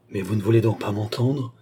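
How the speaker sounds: usual speaking voice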